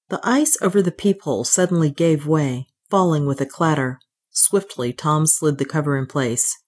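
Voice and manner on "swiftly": ordinary delivery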